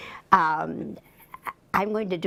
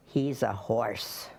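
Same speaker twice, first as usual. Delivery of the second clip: slow awed dog voice; 6 semitones lower, slower than usual. Acted. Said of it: "said Ricky".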